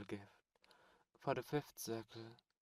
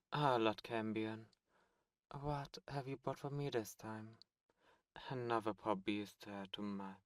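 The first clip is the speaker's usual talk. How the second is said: normal talking voice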